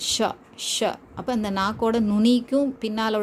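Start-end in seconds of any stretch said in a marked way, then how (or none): 0.1-0.9 ஷ as sha with air rubbing through; slow, hissing sh release on each ஷ; ஷ with breath friction. Shown as a demonstration. Teacher demonstrates breath friction, fricative ஷ. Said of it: "சுவாச காற்று உரசலுடன்".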